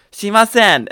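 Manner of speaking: put-on high, loud Japanese-accented refusal; old man voice. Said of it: "it said".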